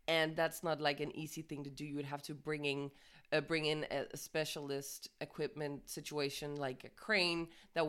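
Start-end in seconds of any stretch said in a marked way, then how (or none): none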